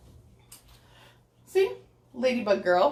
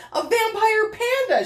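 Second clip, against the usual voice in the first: proclaiming; 12 semitones higher, 4 dB louder than usual. Acted. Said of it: "she proclaims".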